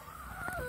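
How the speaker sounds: high, soft giggle; laugh. Real, not acted. Speaker amused by their own remark, high soft laugh. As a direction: let a quiet high-pitched giggle out.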